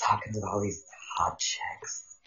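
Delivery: soft breathy nerd voice; Kip impression, whispered. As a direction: hush it like a shy dork. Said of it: "that's his name, Kip".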